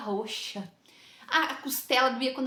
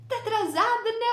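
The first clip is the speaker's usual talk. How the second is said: higher, louder mock reproach; imitating scolding woman; 10 semitones higher, 5 dB louder than usual. The woman at the door scolding the latecomer, mock reproachful. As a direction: mimic her pouncing on the lateness. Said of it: "ela olhou pra minha cabecinha".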